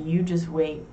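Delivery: normal talking voice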